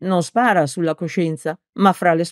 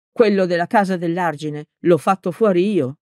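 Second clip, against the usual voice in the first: usual speaking voice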